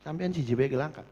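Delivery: usual speaking voice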